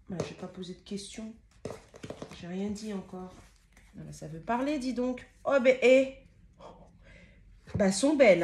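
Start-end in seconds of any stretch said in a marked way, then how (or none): 5.4-8.4 loud, excited gasp of admiration; amazed. Real, not acted. Card reader amazed by the cards she drew, loud and delighted.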